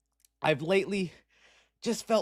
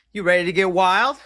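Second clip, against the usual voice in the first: louder hype call; 6 dB louder than usual. Acted. Repeat of the hype opener.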